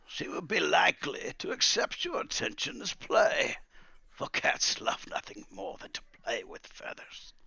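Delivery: high start sinking into breathy relish; crafty old master advising; old wizard, sly. Acted. Wizard advising his feathered scout about cats, sly.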